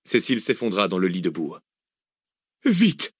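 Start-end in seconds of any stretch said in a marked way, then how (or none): 2.6-3.1 high urgent call. Acted. Arsène hurrying the others, urgent. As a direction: hurry them on. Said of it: "enchaîna Arsène".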